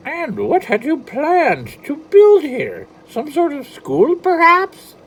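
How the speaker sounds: high, loud, slow leprechaun question; a tiny leprechaun prying; sly leprechaun. Acted. Leprechaun Patrick asks.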